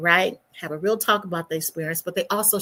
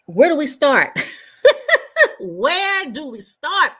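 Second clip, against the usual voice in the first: higher and louder, breaking into laughter; laughing disbelief; 8 semitones higher, 7 dB louder, slower than usual. Real, not acted. Host laughing at the overwhelming news to her viewers. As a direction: laugh at how much there is to cover.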